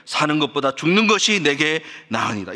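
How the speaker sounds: ordinary talk, nothing marked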